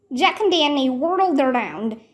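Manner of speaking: ordinary delivery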